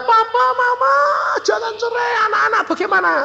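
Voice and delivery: very high-pitched pleading yell; imitated children's screaming. Children begging papa and mama not to divorce, imitated screaming. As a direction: mimic a clamour of shrieking kids. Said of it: "Teriak-teriak".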